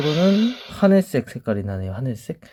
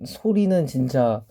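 ordinary talk, nothing marked

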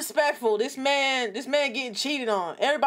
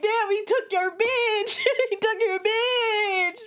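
slow words squeezed out between real giggles; laughing too hard to get it out; giggling; 5 semitones higher, slower than usual. Real, not acted. Mocking the dumped guy to chat, giggling through it. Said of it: "I'm out here giggling".

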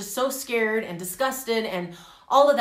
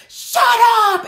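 loud imitated yell, drawn out; yelling back; 13 semitones higher, 12 dB louder than usual. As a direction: yell it back in someone's face. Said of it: "And they're yelling".

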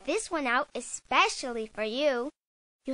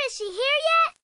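much higher, eager asking; can't wait any longer; impatient; 10 semitones higher, 3 dB louder than usual. Acted. Child hiding for the surprise, high and impatient.

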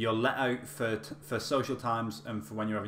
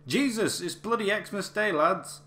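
shouted high and loud in broad northern English; thick northern accent; 11 semitones higher, 7 dB louder, slower than usual. Retold or imitated. Prisoner shouting at lads fighting on Christmas morning, thick northern accent. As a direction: imitate an exasperated northern shout. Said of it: "in a really thick northern accent".

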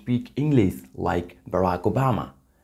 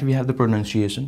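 normal talking voice

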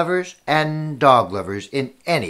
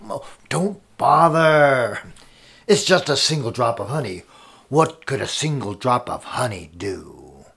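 dismissive king; 4 semitones lower than usual. Acted. King voiced dismissing the servant.

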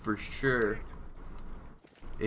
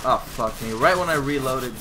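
loud, exasperated outburst; frustrated shout; 2 semitones higher, 7 dB louder than usual. Real, not acted. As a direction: yell it out in frustration. Dies mid-reload in match.

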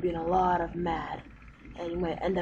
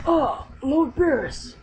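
high, loud and slow, caught off guard; startled gasp; 6 semitones higher, 7 dB louder, slower than usual. Acted. Servant spotting Lord Beerus arriving, startled cry. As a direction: gasp out his name in alarm.

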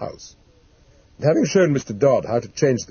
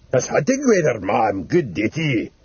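an old man's contented rambling, lightly acted; cheerful rambling. Delirious old man dreaming of the hunt, mildly cheerful. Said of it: "he said quite cheerfully".

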